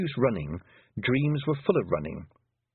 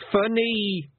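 put-on high small-child voice; amused toddler; chuckling toddler; 10 semitones higher, 3 dB louder than usual. A narrator's reading. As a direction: say it like a tickled little boy.

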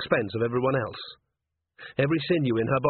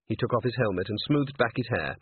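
ordinary delivery, nothing marked

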